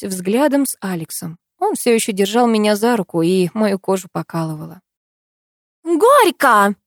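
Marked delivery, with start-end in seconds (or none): 5.8-6.7 high, loud celebratory squeal. A narrator's reading. Neighbour urging the couple to kiss, squealing. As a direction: squeal it out suddenly. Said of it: "вдруг взвизгнула соседка".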